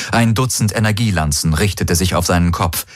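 plain reading voice

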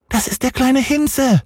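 sehr hohe Kinderstimme; kleiner Häwelmann. A narrator's reading. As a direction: ein Junge erklärt eifrig. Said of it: "sagte Häwelmann". (hohe, eifrige Kinderstimme)

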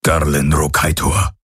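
low booming alien voice; deep creature voice. A narrator's reading. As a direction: rumble the name. Creature says the name.

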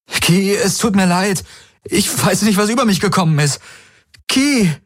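high-pitched, urgent apology; desperate pleading. Acted. Man runs after the woman he hurt, pleading. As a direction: rush the excuse, then cry her name. Chases her, pleading for forgiveness.

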